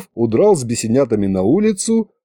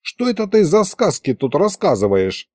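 usual speaking voice

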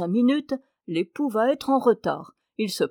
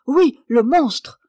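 indignant cry; 4 semitones higher, 8 dB louder, slower than usual. Acted. Wife rails at hairdresser.

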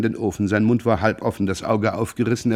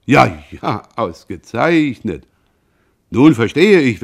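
mocking; 2 semitones higher, 7 dB louder, slower than usual. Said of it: "lachte nur noch lauter".

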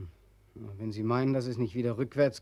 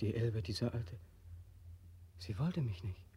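normal talking voice

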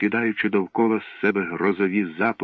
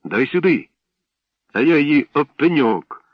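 higher, louder shouted exchange; heated quarrel; 6 semitones higher, 6 dB louder than usual. A narrator's reading. Boys quarrel over the flower, shouting. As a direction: fight over it out loud.

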